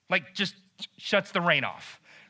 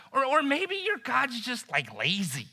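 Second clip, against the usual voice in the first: taunting; 3 semitones higher, 4 dB quieter than usual. Retold or imitated. Elijah suggests a reason Baal stays silent, taunting. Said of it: "began to taunt them".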